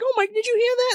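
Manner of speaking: high, mock-startled gasp. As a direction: squeak it out, playfully startled. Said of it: "they're like".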